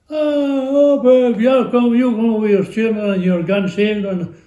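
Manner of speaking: high, loud coaxing; urging a tired friend to bring his grandchildren, high and loud; eager urging. Retold or imitated.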